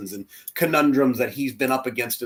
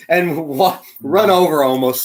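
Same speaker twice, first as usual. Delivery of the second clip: ordinary delivery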